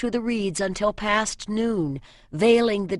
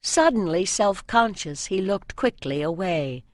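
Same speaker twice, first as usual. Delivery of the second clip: plain reading voice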